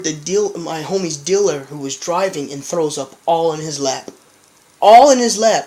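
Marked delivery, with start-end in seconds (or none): 4.8-5.7 louder, higher punchline; storyteller shouting the gross punchline again; loud emphatic repeat. Real, not acted. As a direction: hammer the punchline home.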